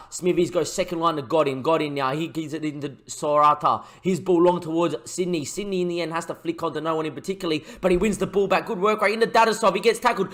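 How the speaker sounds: lively match commentary, building on the chances; play-by-play commentary. Acted. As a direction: call the game like a TV commentator. Commentating the highlight.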